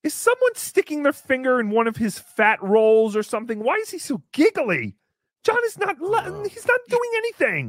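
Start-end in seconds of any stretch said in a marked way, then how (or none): 5.4-7.7 very high, whiny-pitched protest; high-pitched indignant disbelief; exasperated protest. Pretend. Exasperated at Chad's giggling.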